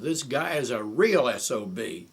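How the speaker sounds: retold angry insult at volume; imitated raving. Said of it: "he had a voice you could hear for miles away".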